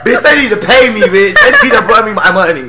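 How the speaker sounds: loud, high, laughing boast. Real, not acted. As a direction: laugh through the brag. Laughing boast about pay.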